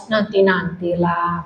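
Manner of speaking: slow, low, soft brush-off; ogah-ogahan. Retold or imitated. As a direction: drag out a mock-indifferent reply, quietly. Tuhan ditiru menjawab dengan ogah-ogahan. (tiruan jawaban malas, pelan dan rendah)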